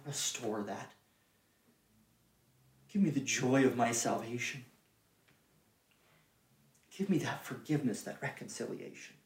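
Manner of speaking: very soft, low, near-whispered plea; hushed pleading prayer. Acted. Sinner asking the Father for forgiveness, hushed pleading prayer. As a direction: pray it humbly, almost under your breath. Voices a humble prayer of confession.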